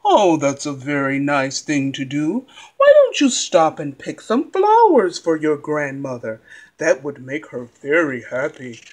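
low, sly fox voice. Acted. Fox keeps talking to girl.

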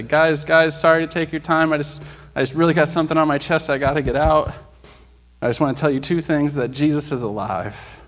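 standing in the aisle, calling out to passengers; public address. Retold or imitated. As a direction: announce to the whole plane. Addressing plane passengers loudly.

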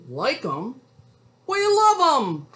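enthusiastic wolf. A narrator's reading. Wolf answers cowboy about lullabies, loud high enthusiasm. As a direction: burst out as a delighted storybook wolf. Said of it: "And the wolf says".

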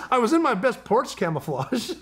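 high quote broken by laughter; can barely get the line out for laughing; laughing through quote. Retold or imitated. Quoting brother while laughing.

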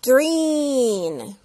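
green with a long stretched ee. Shown as a demonstration. Teacher stretching the vowel of green for children. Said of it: "con una I muy larga".

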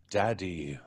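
usual speaking voice

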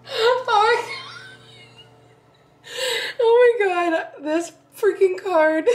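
high, tearful real laughter; cracking up. Real, not acted. Friend reacting to an inside-joke card, cracking up. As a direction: squeal it slowly through helpless laughter.

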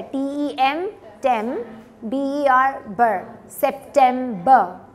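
loud, drawn-out blend of सितंबर in three syllables; broken into syllables. Shown as a demonstration. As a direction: spell out tem and ber, then सितंबर slowly as sep-tem-ba. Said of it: "आप उसको break कर लें". (टेम, बर, फिर सितंबर तोड़कर धीरे)

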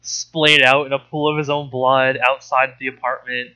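ordinary talk, nothing marked

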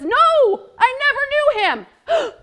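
high, loud protest; loud denial. Acted. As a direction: deny it loudly and fast. Peter's second denial.

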